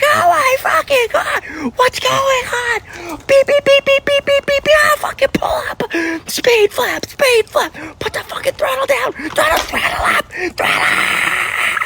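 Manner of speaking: high, frantic cockpit alarm panic; panicked pilot in a crash. Retold or imitated. Comedian imitating a plane crash in panic. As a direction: shout the crash warnings in a shrill panic. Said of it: "It's like".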